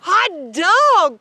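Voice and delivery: loud, high shout of joy; excited cry. A narrator's reading. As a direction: whoop it out like thrilled kids. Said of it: "cried the kids".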